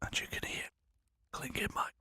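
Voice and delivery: hushed whisper into the mic. Shown as a demonstration. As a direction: whisper very softly to test the mic. Demonstrating what the mic picks up.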